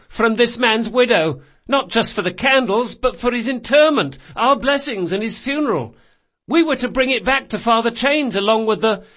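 put-on high, loud earnest boy; distressed boy Galdo. Galdo to old woman about stolen payment, high urgent explaining. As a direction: pile up the details urgently.

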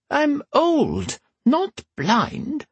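put-on high creaky old empress, dry; old woman voice. A narrator's reading. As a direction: tart old woman's retort. Whisper's voice held.

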